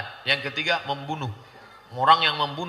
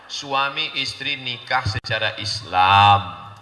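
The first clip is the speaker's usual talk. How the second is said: ordinary delivery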